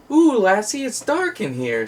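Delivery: voodoo chicken guy. Retold or imitated. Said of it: "voodoo chicken guy ... goes".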